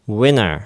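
"winter" said as "winner", no T at all; T dropped. Shown as a demonstration. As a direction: drop the T so "winter" sounds like "winner". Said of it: "Tの音を飲み込んでしまって". (Tを落として「winner」と発音)

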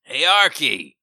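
ordinary talk

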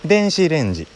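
Kansai accent. Shown as a demonstration. Repeating 電子レンジ for listeners as Kansai people say it, in Kansai intonation. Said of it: "関西弁は上から下がってくる".

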